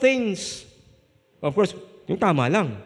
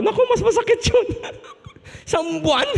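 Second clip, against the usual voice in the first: much higher, fast, laughing complaint; 4 dB louder, faster than usual. Real, not acted. Laughing about sleeping apart.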